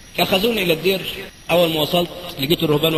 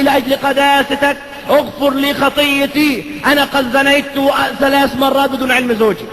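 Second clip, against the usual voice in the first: slow, higher and louder plea for forgiveness; pleading penitent woman; 7 semitones higher, 4 dB louder than usual. Acted. Woman confessing adultery to the priest, slow earnest plea. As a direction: beg the priest for pardon.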